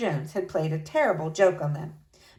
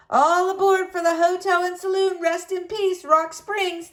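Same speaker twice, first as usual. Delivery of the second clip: hollered call, a touch louder and higher; hollering driver; 10 semitones higher, 3 dB louder than usual. A narrator's reading. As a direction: call out to the passengers like a sleigh driver. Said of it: "the driver hollered".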